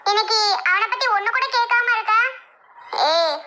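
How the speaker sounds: usual speaking voice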